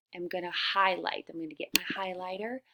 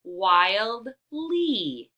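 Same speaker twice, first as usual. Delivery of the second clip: word in two parts; 8 dB louder than usual. Shown as a demonstration. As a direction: wildly split as "wild, lee" with a gap. Teacher sounds out parts before blending.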